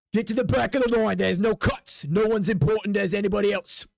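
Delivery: officious gatekeeper voice; bossy guard. Acted. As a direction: order them out of the queue. Guard orders player to back.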